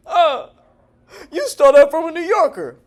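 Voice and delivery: high, loud mimicked accusation; mock accuser. Critic accusing him of stealing a word, mock outrage.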